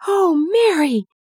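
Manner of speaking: high, emotional cry, narrated not sobbed; overwhelmed cry. A narrator's reading. Colin learns Mary found the secret garden, overwhelmed cry. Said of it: "he cried out, nearly sobbing".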